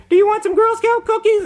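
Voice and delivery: put-on very high, loud little-girl sales pitch; Girl Scout. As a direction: pipe up like a Girl Scout at the door. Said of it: "they say".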